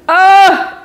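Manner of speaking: high 'oh' of pain; imitated pained moan. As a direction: moan as if straining to hold on. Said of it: "and I'm like".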